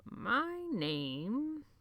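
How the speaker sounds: wondering. A narrator's reading. Old librarian asked his name by Jonathan, wondering. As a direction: muse over the question with wonder. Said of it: "His voice was tinged with wonder".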